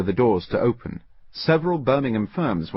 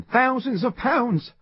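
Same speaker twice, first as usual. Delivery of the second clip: much higher, slow anguished outcry; distressed cry; slower than usual. Acted. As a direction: lament the lost money. Simpson cries out the loss.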